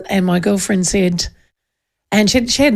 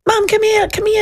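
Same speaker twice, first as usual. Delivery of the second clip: put-on child calling mum; 11 semitones higher than usual.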